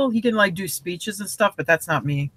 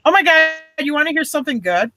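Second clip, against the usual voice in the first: ordinary talk, nothing marked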